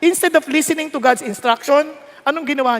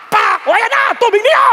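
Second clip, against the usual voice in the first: high, loud outburst while striking; hammed-up furious Moses shout; acted anger; 12 semitones higher, 7 dB louder than usual. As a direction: shout it with each blow. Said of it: "Sa galit niya".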